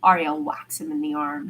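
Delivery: plain reading voice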